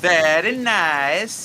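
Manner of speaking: loud, stretched-out catchphrase in mock foreign accent; Borat. Friend joining in on the Borat quoting, Borat impression. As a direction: draw it out with broad approval. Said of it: "are we quoting Borat right now?".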